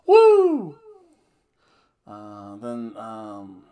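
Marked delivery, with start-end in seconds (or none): 0.1-0.8 shrill, very high, loud woo; Ric Flair woo. Retold or imitated. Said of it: "Ric Flair, though".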